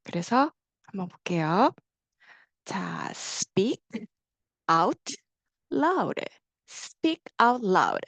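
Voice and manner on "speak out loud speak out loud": slow, drawn-out model phrase; teacher modeling a phrase word by word; speak out loud. Shown as a demonstration. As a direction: say each word slowly and clearly for students to repeat. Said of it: "밖으로 소리 내서 말씀하셔야 됩니다".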